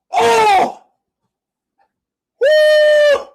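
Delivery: high, loud whoop of celebration; joyful cheer. Real, not acted. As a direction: cheer the goal at full voice. Celebrating a goal.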